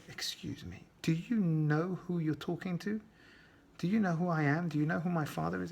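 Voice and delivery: the brat leaning on his father's name; defiant kid. Acted. Kid defies the teacher threatening to rip his exam, lightly voiced and insistent.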